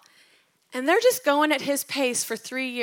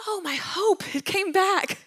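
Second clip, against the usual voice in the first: pitched up high, rushing with hope; eager joy; 8 semitones higher, 3 dB louder than usual. Retold or imitated. Mary lunging toward the risen Jesus, high excited cry. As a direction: she lunges at him, overjoyed. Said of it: "imagining her lunging toward him like".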